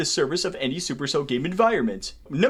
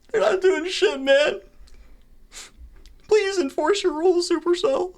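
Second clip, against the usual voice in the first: much higher pleading whine; whiny plea; 10 semitones higher than usual. Real, not acted. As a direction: beg the company in a crying tone.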